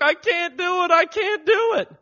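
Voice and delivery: loud, high-pitched wail of despair; hammed-up tearful protest; mock crying. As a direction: imitate a daughter wailing in defeat. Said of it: "Robin starts crying".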